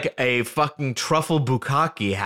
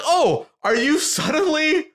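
much higher, slow, laughing mock outrage; incredulous shout; 8 semitones higher, 3 dB louder, slower than usual. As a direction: yell it in disbelief at the friend.

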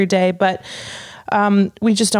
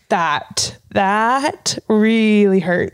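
drawn-out, mock hurt; slow, wounded; much slower than usual. Telling friend about boarding embarrassment, slow mock hurt. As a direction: drag it out like it stung. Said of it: "I was literally like".

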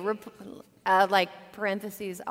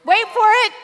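high and loud build-up before the punchline; teasing suspense; 11 semitones higher, 13 dB louder, faster than usual. Acted. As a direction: dangle the punchline, teasing the crowd.

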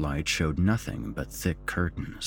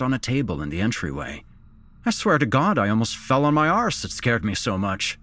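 higher, lively character voice; animated policeman telling a scare; 8 semitones higher, 3 dB louder, faster than usual. Acted. Phil to Kim about a giant gnome, animated storytelling. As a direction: recount a fright with rising emphasis.

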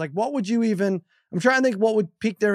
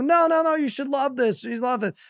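high-pitched exasperated retold insisting; mock-indignant dad, voice pitched up; getting mad; 8 semitones higher than usual. Dad insisting the kids love Atari, getting mad. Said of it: "I'm, like, getting mad".

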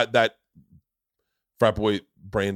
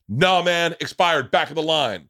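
higher, louder bouncer refusing entry; gruff bouncer; 5 semitones higher, 4 dB louder, slower than usual. Retold or imitated. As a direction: bark it like a tough doorman. Bouncer voiced in retold story.

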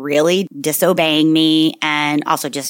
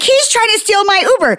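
much higher, outraged retelling; indignant protest; 13 semitones higher, 3 dB louder than usual. Retold or imitated. Rider appealing to the driver, indignant protest.

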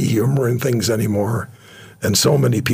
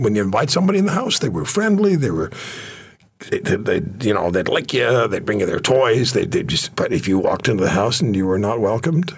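usual speaking voice

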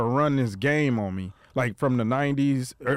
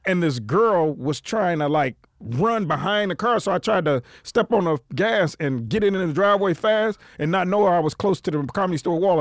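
ordinary talk

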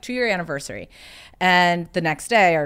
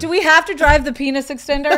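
higher and louder, mock-exasperated; mock whiny complaint; 9 semitones higher, 8 dB louder than usual. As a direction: whine it like a fed-up girlfriend teasing. Teasing her fiancé about his car.

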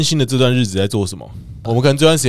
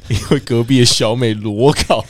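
ordinary delivery

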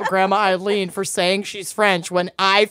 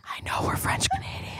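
unvoiced stage whisper; 14 dB quieter than usual. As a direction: whisper it into a dying ear. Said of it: "whisper it in her ear".

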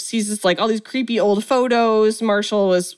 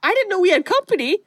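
high-pitched, hurried fake apology; mock apologetic surprise; 9 semitones higher, faster than usual. Aaron walking in on Matthew's move, in high mock surprise. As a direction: play the interrupter in a high, quick voice.

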